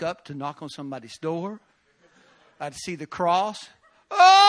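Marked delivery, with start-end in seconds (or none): 4.1-4.4 very high loud sudden cry; sharp wailing gasp; overcome cry. Retold or imitated. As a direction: burst out at the sight of the cross. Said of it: "And I'd start weeping".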